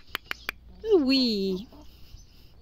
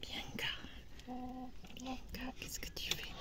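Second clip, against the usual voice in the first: hushed whisper, checking on her; 19 dB quieter than usual. Real, not acted. Owner whispering to her hen Bianca. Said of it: "whispering to hen".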